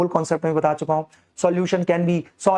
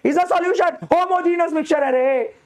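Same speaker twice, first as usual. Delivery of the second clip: emphatic drawn out; 12 semitones higher, much slower than usual. Acted. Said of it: "stretched emphatic teacher line".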